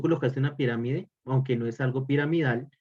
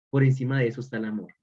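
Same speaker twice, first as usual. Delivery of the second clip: brisk assertion; faster than usual. Shown as a demonstration. Repeated for emphasis.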